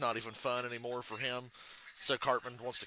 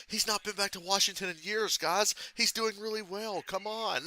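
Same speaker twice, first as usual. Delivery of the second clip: put-on higher, pleading boyfriend voice; defending boyfriend; 8 semitones higher than usual. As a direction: soothe the angry crowd, a touch pleading. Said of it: "his boyfriend's like".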